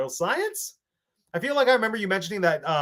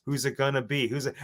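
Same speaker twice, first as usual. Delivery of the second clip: normal talking voice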